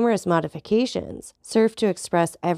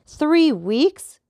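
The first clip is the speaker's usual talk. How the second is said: higher, louder outraged cry; cried out; 9 semitones higher, 6 dB louder than usual. A narrator's reading. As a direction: burst out in disbelief. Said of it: "cried Albert".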